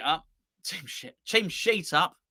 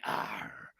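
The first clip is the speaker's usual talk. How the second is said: pirate; 11 dB quieter than usual. Shown as a demonstration. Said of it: "All dress as pirates and say".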